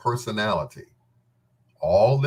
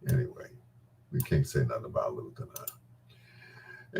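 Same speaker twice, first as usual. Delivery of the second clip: much lower and softer, under the breath; hushed aside; 11 semitones lower, 10 dB quieter than usual. Real, not acted. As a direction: drop it low like a secret. Hushed aside about Luther.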